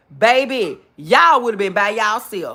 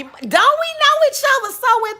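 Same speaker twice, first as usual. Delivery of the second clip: much higher, playful sing-song tease; high teasing singsong; 5 dB louder than usual. Acted. Teasing a viewer, Miss Alabama.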